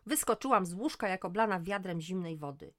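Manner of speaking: ordinary delivery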